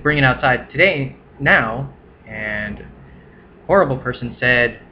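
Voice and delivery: ordinary delivery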